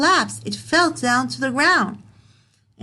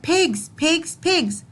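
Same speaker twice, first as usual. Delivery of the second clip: higher, a mild mock shout; shouting wolf; 8 semitones higher than usual. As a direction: call out harder each time. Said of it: "He shouted at them".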